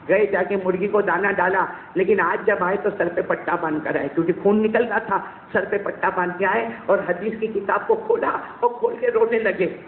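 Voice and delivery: normal talking voice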